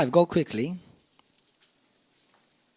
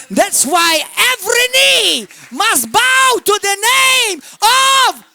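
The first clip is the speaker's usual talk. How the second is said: preaching shout; 13 semitones higher, 7 dB louder than usual. Real, not acted. Preacher rousing the congregation at the name of Jesus, shouting. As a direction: cry it out to the congregation, loud and high.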